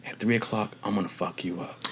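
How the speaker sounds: the bully's hushed playground threat; quiet threat. Retold or imitated. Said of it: "he goes".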